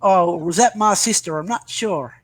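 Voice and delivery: ordinary talk, nothing marked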